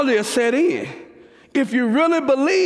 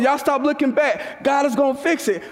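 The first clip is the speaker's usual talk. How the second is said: ordinary talk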